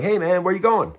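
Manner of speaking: high, loud hey of a guard stopping someone; lieutenant calling out to an inmate walking out, high and loud; mock lieutenant call. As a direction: call out like a prison lieutenant who spots you leaving. Lieutenant stops him leaving.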